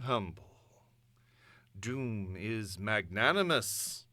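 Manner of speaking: a conqueror addressing the whole world; triumphant broadcast. Acted.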